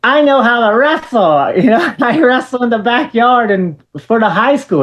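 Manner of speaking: put-on teen tells wrestler he can wrestle; eager fan pitch. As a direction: pitch yourself nervously to your hero. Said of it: "I was like".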